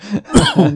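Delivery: sharp cough from swallowing coffee wrong; coughing after choking. Real, not acted. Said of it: "engasguei com café".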